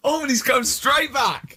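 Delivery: high, slow, laughing through the words; giggling delight at the comeback. Real, not acted. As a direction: squeal it out between laughs. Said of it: "I can't come back to that".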